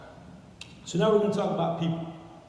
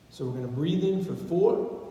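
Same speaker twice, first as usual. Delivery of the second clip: normal talking voice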